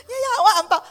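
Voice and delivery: high, loud eager auntie; eager mum voice. Retold or imitated. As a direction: chirp it like a delighted mum.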